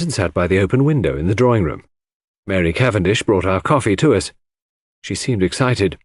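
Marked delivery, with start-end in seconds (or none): none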